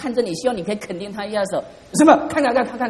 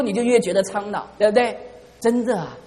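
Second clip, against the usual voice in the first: normal talking voice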